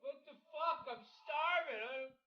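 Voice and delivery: high, thin, drawn-out wail of hunger; very high mock-pitiful moan; whining complaint.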